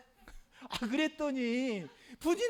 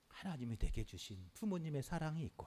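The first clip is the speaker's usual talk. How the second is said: usual speaking voice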